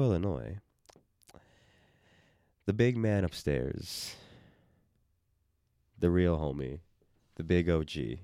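low, calm storyteller voice; epic fantasy narrator. Pretend. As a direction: tell it like an ancient legend. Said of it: "my voice just went down ... super calm".

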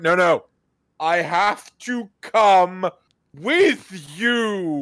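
high, loud, drawn-out pleading; hammed-up insistence, voice up and slowed; mock insistent. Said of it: "And she's like".

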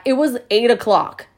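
ordinary talk

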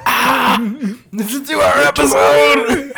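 high laughing shout of mock despair; excited shout. Real, not acted. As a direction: yell it out, half laughing. Mock horror at long episode.

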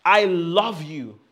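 shouted slowly, full voice; bold, slow shout from the spirit; loud declaration. Shown as a demonstration. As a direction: proclaim it as loud as you can. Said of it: "As loud as you can and from your spirit".

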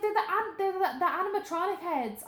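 high, urgent pointing it out; high-pitched worked-up explanation; agitated telling. Retold or imitated. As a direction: insist it's the heads. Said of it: "I was like".

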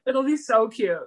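ordinary talk, nothing marked

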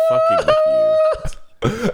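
squeaky high. Real, not acted.

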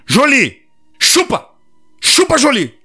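high, insistent order to dog; stern command. Retold or imitated. Owner ordering dog again.

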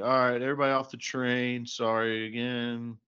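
retold announcement, pushed up high and loud; imitated train announcement. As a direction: call out to a carriage like a train conductor. Retelling conductor's announcement to passengers.

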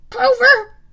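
high, very loud desperate call; desperate shout to a friend. A narrator's reading. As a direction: cry out desperately for rescue. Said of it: "I thought desperately".

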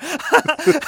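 a loud, high burst of laughter; high embarrassed laugh. Real, not acted. Remembering getting caught backstage, high embarrassed laugh.

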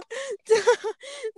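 crying laugh. Real, not acted. Laughing after protest.

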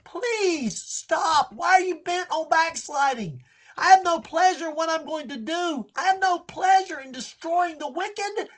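high, strained, anguished pleading; God pleading. Acted. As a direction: beg them like a grieving father. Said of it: "You can hear him pleading with them".

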